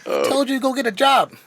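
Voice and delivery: high scolding mom voice; yelling mom. Retold or imitated. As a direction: scold him like an angry mom. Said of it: "his mom is gonna yell at him".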